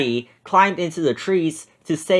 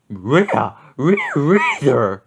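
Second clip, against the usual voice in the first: a cartoon dog mumbling yes; Scooby agreeing; much slower than usual. A narrator's reading. Scooby agreeing with Shaggy, slow dog voice. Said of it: "Scooby agreed".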